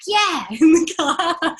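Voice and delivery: laughing. Retold or imitated. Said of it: "And I'm like".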